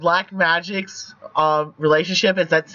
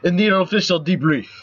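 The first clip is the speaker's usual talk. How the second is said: louder, put-on Russian accent; 2 semitones higher, 5 dB louder than usual. Game player voicing the hero Rebellion at introductions, Russian accent. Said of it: "proper time to do the more Russian accent".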